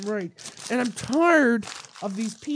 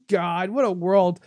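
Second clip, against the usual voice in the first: ordinary talk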